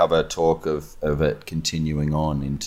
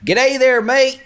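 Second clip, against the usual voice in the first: much higher and louder, hammed-up Australian G'day; mock Australian greeting; 6 dB louder, slower than usual. Australian actor asked for American, jokes in a hammed-up Australian accent. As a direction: play the Australian cliché instead of the American accent, loud and high. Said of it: "Let's hear the American accent".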